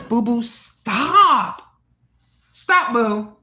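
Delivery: real yell across the room at the pet; slow, drawn-out shout to the cat. Real, not acted. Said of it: "I gotta yell at my cat".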